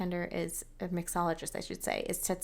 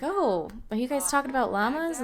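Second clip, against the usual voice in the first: bubbly question; 6 semitones higher, 5 dB louder than usual. Pretend. Voicing Sim, excited question.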